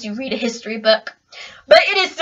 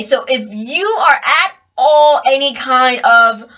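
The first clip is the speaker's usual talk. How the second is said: normal talking voice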